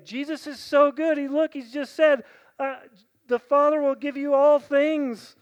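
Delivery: high, loud joyful preaching; exultant pulpit praise; preaching at full force. Acted. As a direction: lift the room with delight.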